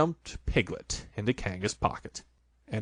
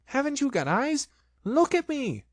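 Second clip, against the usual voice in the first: high, indignant shout. A narrator's reading. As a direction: Piglet yelling at Kanga. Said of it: "shouted Piglet".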